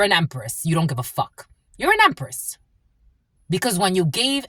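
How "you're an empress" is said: much higher, proud exclamation. Real, not acted. Hyping up the viewer, high. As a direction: lift it up high.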